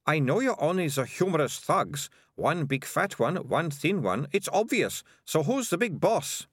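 put-on high, know-it-all girl's voice; bossy confident girl. A narrator's reading. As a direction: confront the thugs as if solving the case.

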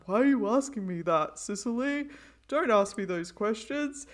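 lightly voiced laid-back dude; a hint of a surfer bro. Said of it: "your dude voice is so good".

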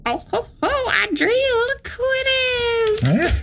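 put-on high old crone croon; witch voice. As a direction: coo like a wicked witch. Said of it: "I sound like an old woman".

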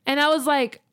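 ordinary talk, nothing marked

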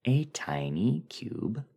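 eerie whisper. Acted. Dreamer finding the cube in the desert, slow whisper. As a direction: let it out as an awed whisper.

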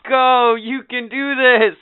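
high, loud, slow mock cheering; husband mock-cheering his wife through labor; mock cheerleader. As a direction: cheer her on like a sideline coach. Mocking a cheering birth partner.

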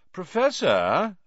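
high, slow drawl with a rise; Malfoy's drawl. A narrator's reading. Pupil summoning Snape to tattle, drawling. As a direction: draw the teacher's title out high. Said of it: "drawled Malfoy".

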